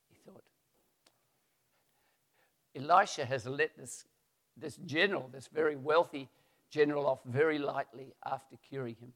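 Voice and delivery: normal talking voice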